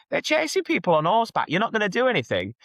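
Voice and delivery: put-on high-pitched, indignant Manchester accent. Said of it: "gonna do my best Manchester accent".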